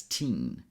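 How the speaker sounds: put-on TEEN with a long EE, as in teenager. Shown as a demonstration. Teacher fixing a often misread last syllable, "teen" with long EE. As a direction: say "teen" with a clear tense EE vowel. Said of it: "pronounce this last syllable as".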